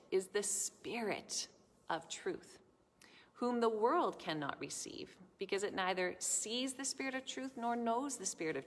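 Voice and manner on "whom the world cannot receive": ordinary talk, nothing marked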